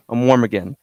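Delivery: ordinary talk, nothing marked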